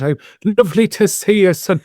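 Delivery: put-on light, high-pitched Irish accent of a happy grandfather. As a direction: warm hint of an Irish grandad greeting his grandson. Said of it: "in his Irish accent".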